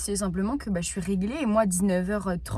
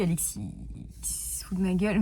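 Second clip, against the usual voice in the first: whispered aside; 4 semitones lower, 6 dB quieter than usual. Real, not acted. Whispered aside about boyfriend.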